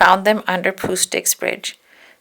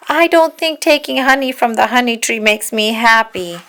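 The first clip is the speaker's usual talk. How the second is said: louder, higher cry; crying out while running; 4 semitones higher, 6 dB louder than usual. Acted. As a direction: call out as he flees. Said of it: "Eeyore cried".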